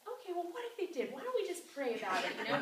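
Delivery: mock-chirpy encouragement; upbeat persuasion. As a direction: sell the idea brightly. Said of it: "I'm like".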